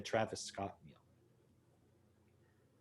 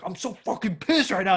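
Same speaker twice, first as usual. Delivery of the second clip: higher, louder mock rant; mock angry; 8 semitones higher, 7 dB louder than usual. Imagined friend fuming when you walk in. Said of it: "he was like".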